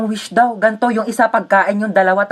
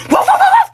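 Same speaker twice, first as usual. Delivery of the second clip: high yappy barks through laughter; barking like a dog; 5 dB louder than usual. Acted. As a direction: yap like a little dog. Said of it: "Gusto mo tumahol ako?".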